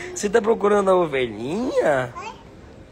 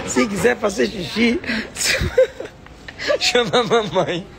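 laughing; 7 semitones higher than usual. Retold or imitated. Quoting mom instructing the toddler.

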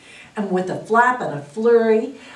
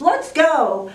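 higher, lightly called-out rallying cry; mild storybook shout; 8 semitones higher, 3 dB louder than usual. A narrator's reading. Badger rallies the search party, mildly shouting. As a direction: call it out high without full force. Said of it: "and he shouts".

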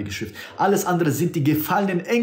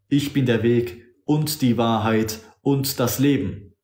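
usual speaking voice

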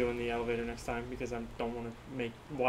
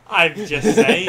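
teasing laugh; 6 semitones higher, 14 dB louder, slower than usual. Real, not acted.

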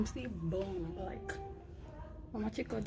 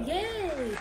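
excited cheer; 9 semitones higher than usual. Real, not acted. Cheering about dentist trip.

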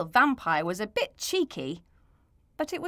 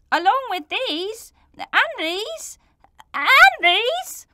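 high, louder with each these; building protest; 8 semitones higher, 8 dB louder than usual. Acted. Bert protesting his innocence to Mister Sniffer.

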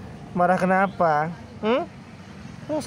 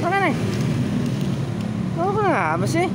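much higher sing-song question to the cat; high pet voice; 11 semitones higher than usual. Real, not acted. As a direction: coo it up high at a sulky cat. Coaxing the cat.

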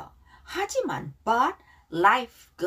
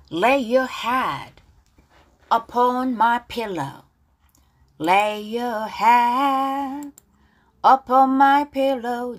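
louder, very slow, stretched words; slow, drawn-out model reading; 3 semitones higher, 5 dB louder, much slower than usual. Shown as a demonstration. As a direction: lay it out gently for students to echo. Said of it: "가사 발음 따라볼게요". (크고 아주 느리게 늘여서)

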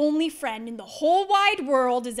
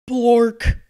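loud nonsense word; 12 dB louder than usual. Acted. Reading a silly nonsense word.

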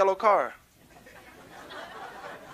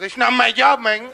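loud, higher landlord catchphrase; put-on landlord voice, higher and louder; dismissive landlord; 4 semitones higher, 8 dB louder than usual. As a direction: throw the refusal back loudly.

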